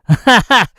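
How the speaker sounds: short loud laugh; brief self-amused laugh. Real, not acted. Laughs at own search trouble.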